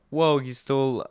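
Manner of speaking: normal talking voice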